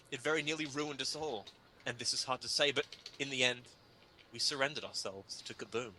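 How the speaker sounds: usual speaking voice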